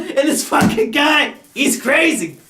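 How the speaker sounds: high, laughing fanboy gush; mocking his twelve-year-old self. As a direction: gush like an excited kid. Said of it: "And I thought".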